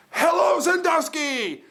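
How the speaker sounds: high, loud, drawn-out stadium yell; rock star greeting. Retold or imitated. Dressed as rock star greeting the conference crowd, huge yell. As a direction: holler to the whole arena. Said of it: "I grabbed the microphone".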